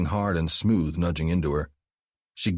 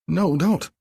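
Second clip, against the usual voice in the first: high, loud pleading no; panicked protest; 12 semitones higher, 5 dB louder than usual. Acted. Abby pleads with Mark to stop, panicked. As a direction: begs him to stop in a voice near panic.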